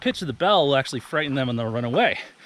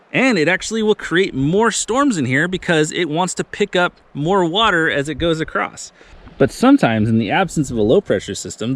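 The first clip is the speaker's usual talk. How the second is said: louder, emphatic whiteboard explanation; lecture voice; 4 semitones higher, 5 dB louder than usual. Acted. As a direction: teach it like a TV weatherman.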